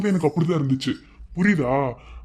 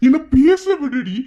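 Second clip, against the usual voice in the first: much higher, raised voice; exasperated outburst; 13 semitones higher, 5 dB louder than usual. Acted. Husband asking wife to let him speak, exasperated. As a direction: plead loudly to get a word in.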